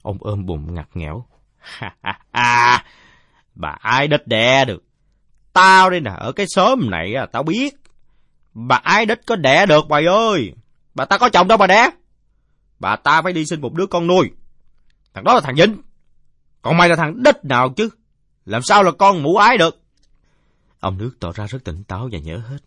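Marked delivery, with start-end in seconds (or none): 1.7-2.8 loud, high guffaw; drunkard's burst of laughter. Acted. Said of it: "ông Nước cười phá lên". 3.6-19.8 put-on loud, high drunken ranting; scoffing drunkard.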